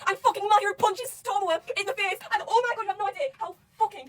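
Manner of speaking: loud, high, drawn-out hype; excited. Real, not acted. Fan retelling the hero's punch, loud and thrilled. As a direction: build the big moment at full volume.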